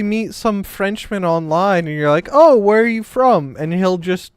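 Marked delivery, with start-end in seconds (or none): 2.3-3.6 mock friendly interest. Acts out greeting a Frenchman online.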